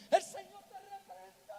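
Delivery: high, loud cry of rebuke; shouted rebuke. Retold or imitated. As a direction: yell it out like a panicked exorcist. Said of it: "que yo empecé a gritar".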